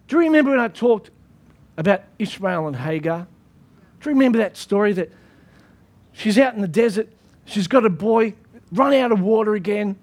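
normal talking voice

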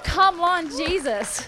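loud, high-pitched elated shout; excited cheer. Retold or imitated. Preacher cheering on Jesus after his bold words, excited shout. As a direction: cheer it out at the top of your excitement.

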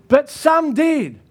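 ordinary talk

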